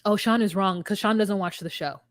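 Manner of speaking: usual speaking voice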